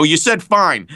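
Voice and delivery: high-pitched, worked-up mock outrage; hammed-up perfectionist protest; indignant retold protest. Retold argument with the director.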